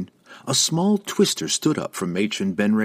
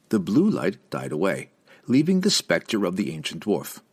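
ordinary delivery

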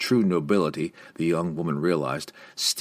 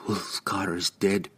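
slow, controlled statement; even; 4 dB quieter, slower than usual. A narrator's reading. Young woman breaking news of a death, evenly. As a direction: say it steadily, braced against grief. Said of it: "she said evenly".

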